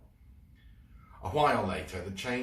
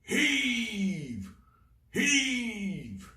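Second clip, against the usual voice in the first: roaring; 6 dB louder than usual. Acted. Captain Claw hauling chain.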